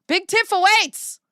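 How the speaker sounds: high, loud, slow proclamation; hammed-up royal-style announcing; grand announcement. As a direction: herald it high and drawn out. Announcing in a put-on voice.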